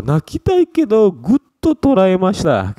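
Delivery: high strained voice, slow, fighting tears; holding back tears. Shown as a demonstration. Teacher acting out holding back tears. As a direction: sound like you are choking back crying. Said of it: "Pengen nangis tapi gue sekuat tenaga".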